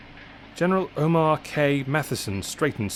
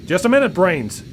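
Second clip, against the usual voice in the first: higher, louder shout; 7 semitones higher, 7 dB louder than usual. A narrator's reading. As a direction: cut him off with a shout. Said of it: "shouted Scott".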